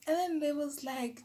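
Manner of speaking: normal talking voice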